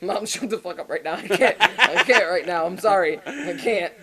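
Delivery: pleading through uncontrollable giggles; helpless laughing. Retold or imitated. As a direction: try to apologize but keep cracking up. High on phone with mom, laughing.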